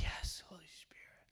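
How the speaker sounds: soft unvoiced whisper; whispered prayer. Believer answering the Holy Spirit, hushed whisper. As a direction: breathe it reverently.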